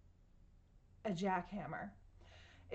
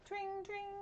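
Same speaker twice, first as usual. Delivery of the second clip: much higher tring, tring; bell ring; 11 semitones higher than usual. Shown as a demonstration. Telling kids about a bike bell: high tring. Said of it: "A bicycle bell ringing".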